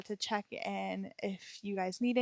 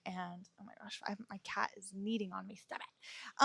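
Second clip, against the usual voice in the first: whispered aside; 3 semitones lower, 7 dB quieter than usual. Real, not acted. Aside about the cat kneading.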